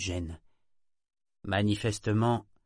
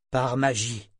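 brusque; 3 semitones higher, 4 dB louder, slower than usual. A narrator's reading. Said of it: "dit Ronica d'un ton brusque".

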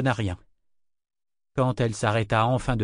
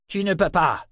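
higher-pitched, barked refusal; curt, snapped objection; 8 semitones higher, 4 dB louder than usual. A narrator's reading. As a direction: bark it out sharply. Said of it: "aboya Bendir".

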